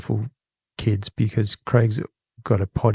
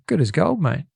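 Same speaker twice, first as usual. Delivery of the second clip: bright Australian reply, pitched higher; 6 semitones higher than usual. Shown as a demonstration. Host teaches kids the Australian reply. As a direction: answer like a cheerful Aussie. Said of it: "you say".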